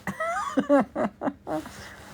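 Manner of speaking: high, helpless laughter; high giggling laugh. Real, not acted. Losing the plot on a stream, high giggles. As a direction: giggle like you've lost it.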